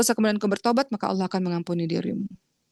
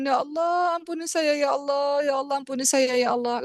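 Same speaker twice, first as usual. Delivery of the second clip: imitated weeping plea; 5 semitones higher than usual. Sinner repeatedly begging Allah to forgive him, imitated crying. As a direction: implore as the crying sinner, voice raised in pitch. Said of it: "Kemudian dia nangis lagi".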